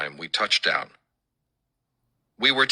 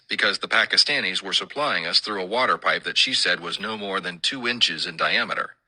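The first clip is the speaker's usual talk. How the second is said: ordinary delivery, nothing marked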